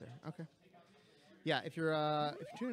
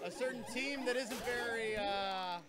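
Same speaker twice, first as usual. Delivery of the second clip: loud, slow, raised announcer voice; event announcer; 4 semitones higher, 7 dB louder, slower than usual. Real, not acted. Organiser calling out a missing team before the start, announcer voice. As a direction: project it slowly across the room.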